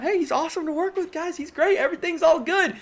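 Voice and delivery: high, sing-song all-is-well; mock upbeat reassurance. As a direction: sell it like everything's great. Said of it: "He's already talking about".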